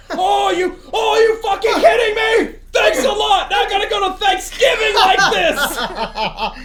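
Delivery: high, loud outraged yelling; hammed-up fury at a friend's prank; angry shouting. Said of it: "And he was so angry".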